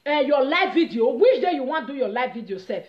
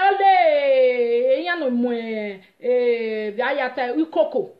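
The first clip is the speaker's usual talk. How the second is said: imitated wailing outcry; 4 dB louder, slower than usual. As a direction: mimic someone crying out, stretching the vowels. Retells someone's cry in an outburst.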